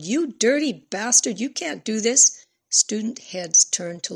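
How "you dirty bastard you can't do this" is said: loud, higher yell at the man. A narrator's reading. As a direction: hurl it at him. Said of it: "She yelled".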